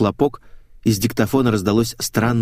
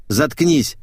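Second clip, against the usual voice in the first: higher, curt angry order; злой окрик; 6 semitones higher, 3 dB louder than usual. A narrator's reading. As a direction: shut her up sharply. Ссора, Ната бьёт Светика. (зло, повышенным голосом)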